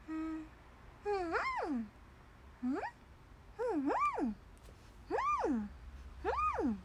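soft, high muffled moans; Nezuko. Acted. Gagged character able only to hum, soft muffled whimpers. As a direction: whimper little hums through a closed mouth. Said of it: "うんしか言えん".

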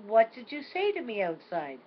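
a child's insistent question, lightly voiced; grandma imitating the grandson's question; grandson asking, insistent. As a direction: press the question like a little boy. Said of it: "he said".

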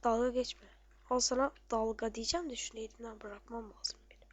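ordinary delivery

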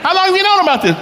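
high-pitched mock disbelief; incredulous parent. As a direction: squawk it like a blindsided parent. Parent learns project is due tomorrow.

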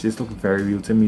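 normal talking voice

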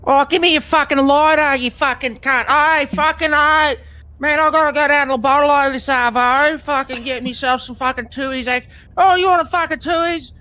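loud, high-pitched Australian Bogan; Bogan accent. Shown as a demonstration. Streamer showing off a favourite accent, loud high Bogan. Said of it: "that's the Bogan accent".

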